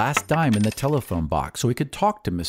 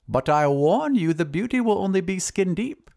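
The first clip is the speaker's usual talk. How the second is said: high wizard voice; high-pitched wizard's warning; cautioning wizard; 9 semitones higher than usual. Acted. Wizard warns the glassblower.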